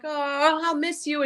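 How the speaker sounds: sweet goodbye. Retold or imitated. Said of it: "Buffy's like".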